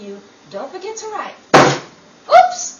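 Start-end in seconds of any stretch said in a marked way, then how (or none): 2.3-2.8 mock oops. [name] knocks Anne off seat.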